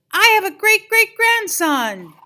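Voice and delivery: high, loud, slow joyful exclamation; overjoyed. A narrator's reading. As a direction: burst out with delight. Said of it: "Ernesto was overjoyed".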